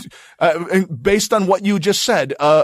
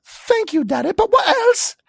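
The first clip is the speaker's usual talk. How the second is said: put-on child voice.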